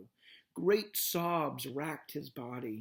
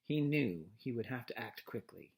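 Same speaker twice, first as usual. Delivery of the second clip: ordinary delivery, nothing marked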